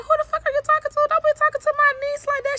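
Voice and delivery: high, fast angry retort; re-enacted protective aunt telling him off; indignant outburst. Retold confrontation over her niece.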